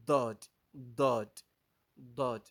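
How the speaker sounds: loud "Dod" with a heavy DH-like D; tongue sides on the teeth for "Dod"; Arabic Daad demo. Shown as a demonstration. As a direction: model "Dod" in separate, punched repetitions. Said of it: "good that you know it from here".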